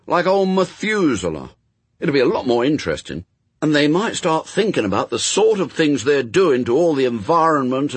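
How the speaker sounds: put-on high, loud, indignant boy; Adam, protesting boy. A narrator's reading. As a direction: argue like a child who finds it all unfair.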